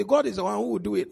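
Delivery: ordinary talk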